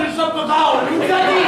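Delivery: usual speaking voice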